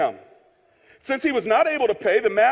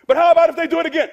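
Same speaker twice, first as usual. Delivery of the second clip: high, loud, rushed objection; acted protest; 5 semitones higher, 5 dB louder, much faster than usual. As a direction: blurt it out as a protest. Objector pleads against the rule.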